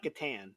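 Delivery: CATAN as kuh-TAN, second A as in "can"; wrong pronunciation. Shown as a demonstration. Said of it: "At least it's not".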